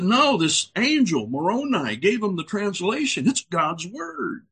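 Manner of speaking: lightly voiced buddy vouching for Joe; earnest buddy. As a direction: sell the angel story earnestly. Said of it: "He said".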